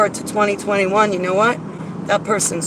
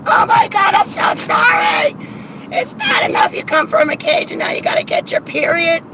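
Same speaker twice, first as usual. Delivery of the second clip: mock crybaby sympathy; 8 semitones higher than usual. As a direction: shriek it like an over-emotional friend. Mocking a crybaby's gushing sympathy.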